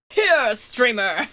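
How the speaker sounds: mock fan call. Mock tossing money at streamer.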